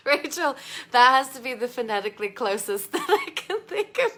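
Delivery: loud, laughing words; amused, voice breaking into laughs; talking through laughter. Real, not acted. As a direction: try to speak while cracking up. Laughing at Rachel's phonetic spelling.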